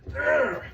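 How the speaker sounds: a high, strained grunt of effort; strong effort grunt. Real, not acted. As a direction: grunt like you're cranking a press tight. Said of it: "make a, a real strong noise".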